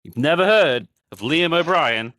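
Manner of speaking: loud, high-pitched disbelief; incredulous outburst. Real, not acted. Fan to friend who never heard of the actor, incredulous. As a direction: exclaim it high and loud, astonished.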